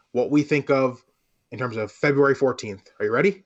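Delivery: ordinary talk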